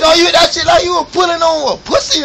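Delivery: high, loud ribbing; loud mocking shout. Real, not acted. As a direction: shout him down laughing. Mocking friend's hit loudly.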